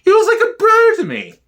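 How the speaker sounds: put-on squeaky falsetto of a doting old lady; high old woman voice. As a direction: pitch it way up like a fond granny. Old woman interviewed about gangster.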